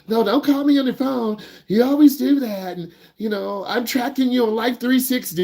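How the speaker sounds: put-on an overprotective mom cutting the kid off; overbearing parent. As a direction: play the hovering, panicky parent. Anxious parent voiced overreacting.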